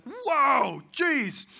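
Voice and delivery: high, loud startled gasp of words; shepherd boys seeing an angel, loud and startled; startled whoa. Retold or imitated. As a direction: jump out of your skin.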